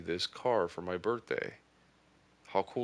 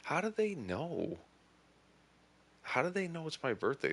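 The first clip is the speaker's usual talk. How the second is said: high, baffled "how do they know"; replaying his stunned reaction, pitched up; quick, high incredulous questions; retold disbelief; 9 semitones higher than usual. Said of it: "and I'm just like".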